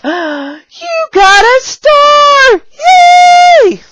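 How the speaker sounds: high, loud, drawn-out celebration; excited cheer. Shown as a demonstration. Teacher celebrating a student's star, excited high cheer. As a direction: shout praise, stretching the words out. Demonstrating praise to a young student.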